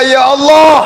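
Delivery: high, loud cry of Ya Allah; crying out to God. Shown as a demonstration. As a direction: call out to God from the heart. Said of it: "ibu baca".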